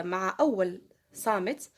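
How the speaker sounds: ordinary delivery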